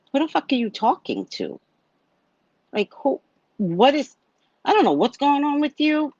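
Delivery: sharp, high indignant comeback; angry retort. Retold or imitated. To the drunk brother yelling at her, indignant and high. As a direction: snap back at him, pitch climbing.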